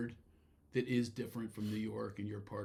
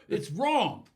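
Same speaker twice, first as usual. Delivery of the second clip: ordinary talk, nothing marked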